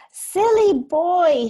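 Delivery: high, loud, slow scolding shout; cross shout. Acted. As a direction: scold him like a furious mother. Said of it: "she shouted".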